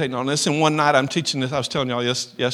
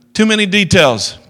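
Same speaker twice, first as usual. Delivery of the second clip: louder, higher interruption; sudden interjection; 5 semitones higher, 8 dB louder than usual. Retold or imitated. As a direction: cut in loudly over the teaching. Paul cuts into his teaching.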